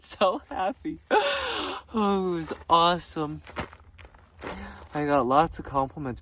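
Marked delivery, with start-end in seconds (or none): 1.0-1.9 gasp. Retold or imitated. Retold girl's delight.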